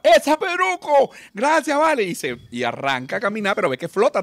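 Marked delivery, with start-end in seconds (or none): 0.0-2.0 high, loud thrilled call; excited shout. Acted. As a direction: spot him and shout with joy. Drunk spots Saint Peter.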